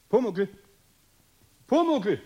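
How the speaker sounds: lautes, hohes Rufen nach dem Kobold. Acted. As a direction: ruf laut in die Werkstatt hinein. Eder ruft den verschwundenen Kobold. (laut rufend)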